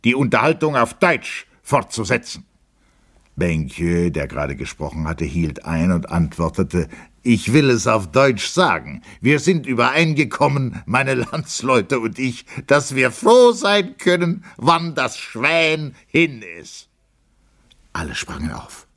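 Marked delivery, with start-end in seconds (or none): 0.0-2.5 higher-pitched formal demand; firm request. A narrator's reading. Captain asks the gentlemen to speak German, firm request. Officer firmly addresses rowdy guests. 7.2-16.9 high-pitched, drawn-out defiant announcement; provocative declaration. A narrator's reading. Benkyö answers the officers in German, provocative declaration.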